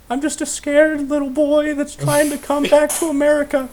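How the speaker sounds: put-on high, little-boy pleading voice; scared little boy. Caught in the truck, pleading in a little boy voice. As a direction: play a frightened child begging. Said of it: "And I go".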